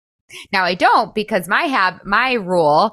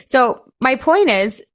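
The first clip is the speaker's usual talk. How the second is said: usual speaking voice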